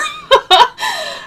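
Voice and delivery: a burst of high laughter; high bright laugh. Real, not acted. Host laughs at her joke about visiting Ontario, high laughter.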